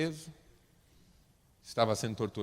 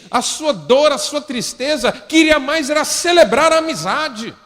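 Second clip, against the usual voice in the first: full-force preaching; 10 semitones higher, 13 dB louder than usual. Acted.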